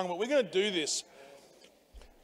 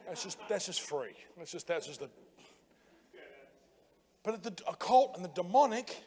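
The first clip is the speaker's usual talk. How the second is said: usual speaking voice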